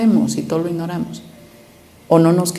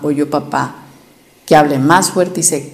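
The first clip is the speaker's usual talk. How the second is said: ordinary talk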